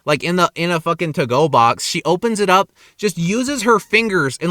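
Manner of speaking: usual speaking voice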